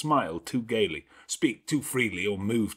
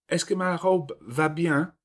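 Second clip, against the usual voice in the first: put-on higher, bouncing little French girl; excited French girl; 8 semitones higher than usual. A narrator's reading. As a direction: cry out, thrilled to show off her dress. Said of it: "cried she, bounding forwards".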